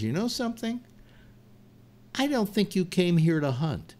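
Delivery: high, soft, sly bear; a bear coyly delivering the punchline; knowing bear punchline. Acted. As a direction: tease him gently from up high.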